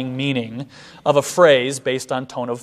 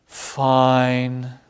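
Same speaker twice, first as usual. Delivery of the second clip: lower, drawn-out "Fine." falling flat; sighing fine; 3 semitones lower than usual. Shown as a demonstration. Teacher shows a second way to answer how are you: low, drawn-out "Fine.". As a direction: let "Fine." sag, slow and low, weary. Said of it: "Or I might say".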